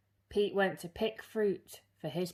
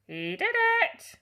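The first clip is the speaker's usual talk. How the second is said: parrot shriek; 7 dB louder than usual. Acted. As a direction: shriek it like a tattling bird. Parrot tattles again.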